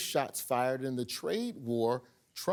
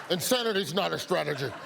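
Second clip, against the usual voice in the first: laughing repeat. Real, not acted. Host laughs while repeating the reporter's line, laughing. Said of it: "laughs while repeating the line".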